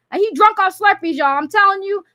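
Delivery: normal talking voice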